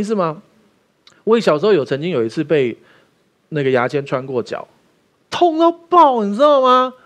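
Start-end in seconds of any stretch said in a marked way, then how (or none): 5.3-6.9 much higher, louder cry of pain; mock pained yelp. Retelling a toothpick through the foot, mock pained cry. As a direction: yelp like it still hurts.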